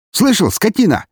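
high, loud abusive yell; angry shout. A narrator's reading. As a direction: shout at the drunk with contempt. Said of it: "крикнул на пьяного полицейский".

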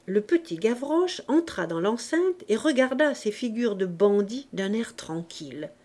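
ordinary delivery

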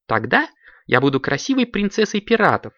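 high, lightly dreamy voice. A narrator's reading. Lapochka picks her pirate role, high and dreamy. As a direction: float it up with a touch of daydream. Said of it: "мечтательно произнесла Лапочка".

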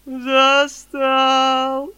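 long drawn-out tearful wail; cracking tearful heartbreak. A narrator's reading. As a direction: howl it through sobs, slowly. Boy wails in tears.

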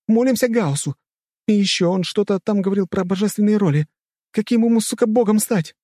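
high-pitched, agitated heroine thinking aloud; high, heated rambling; frantic heroine. A narrator's reading. Heroine improvising a god name.

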